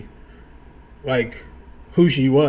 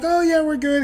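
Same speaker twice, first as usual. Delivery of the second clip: put-on girl's voice; 13 semitones higher than usual. Woman's reply voiced.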